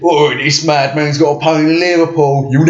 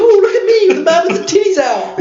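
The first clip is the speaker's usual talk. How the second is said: very high, loud falsetto mimicry; mock lady voice; 3 dB louder than usual. Friend acting out the jacket scene in loud falsetto. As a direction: shriek it in a hammed-up falsetto. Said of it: "He walks and goes".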